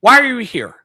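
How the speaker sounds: put-on high, loud, fast old lady; high loud fast elderly lady; confused old woman. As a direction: rattle it off like a bewildered grandma. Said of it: "she'd be like".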